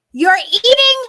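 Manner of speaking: high, loud and slow, almost wailing in disbelief; incredulous outburst. Retold or imitated. Telling a friend about the raw-fish eater, wailing in disbelief. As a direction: stretch it out like you can't believe it. Mocking outrage at dinner companion.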